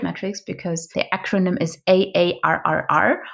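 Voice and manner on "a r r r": growled out like a pirate; rolling pirate arrr. Shown as a demonstration. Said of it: "with my best pirate voice".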